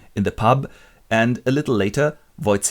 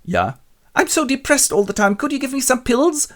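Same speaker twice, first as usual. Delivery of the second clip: much higher, louder plaintive calls; high whiny captain; 6 dB louder than usual. Retold or imitated. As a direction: whine for help. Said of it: "captain in the street".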